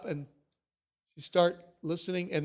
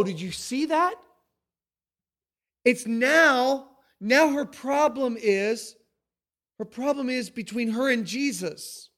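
ordinary talk

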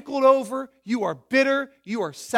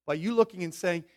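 normal talking voice

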